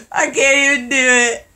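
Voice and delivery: high burst of laughter into words; giggling through the line; laughing. Real, not acted. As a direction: crack up at yourself. Laughing at own failed impression.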